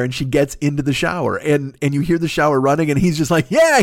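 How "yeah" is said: high, loud, delighted yeah; gleeful. Retold or imitated. Letterman hearing the shower run, gleeful and loud. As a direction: cheer it like a happy kid. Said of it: "he's so happy".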